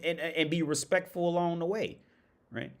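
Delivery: usual speaking voice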